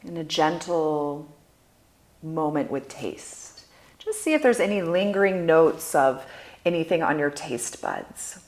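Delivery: soft, unhurried meditation voice with long pauses; guided meditation. Acted. Guide leading listeners through sound, smell and touch, guided meditation. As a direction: guide them gently through each sense, letting phrases fade.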